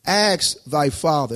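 ordinary talk, nothing marked

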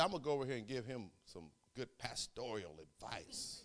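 laughing aside. Real, not acted. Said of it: "'Cause, you know, I'm the pastor".

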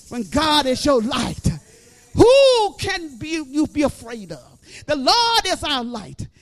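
loud, rising sermon cadence; full-force preaching. Acted. Preacher rousing the congregation, loud and rising.

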